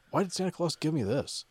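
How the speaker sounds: put-on boy questioning his unlisted present; a hint of a whiny kid. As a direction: ask like a confused six-year-old. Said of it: "He's like".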